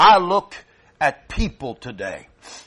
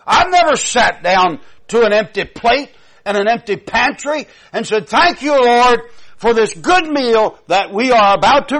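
raised, loud preaching, higher and louder throughout; preaching at full force; 9 semitones higher, 7 dB louder than usual. Acted. Preacher rousing his congregation about faith, loud and high. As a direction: drive it home to the congregation.

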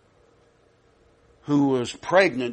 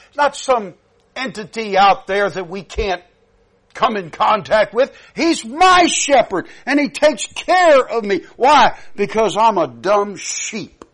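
preaching rise; 7 semitones higher, 6 dB louder than usual. Acted. Preacher rises again.

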